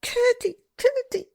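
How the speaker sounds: high, loud desperate calls; calling out. Acted. Princess calls after Curdie as he leaves, loud and high. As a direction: cry his name after him as he goes.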